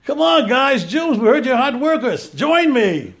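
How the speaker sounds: rally the workers like a showman king; Pharaoh's call. Retold or imitated. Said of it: "He gets into the mud pit and says".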